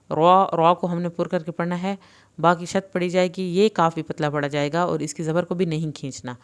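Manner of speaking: usual speaking voice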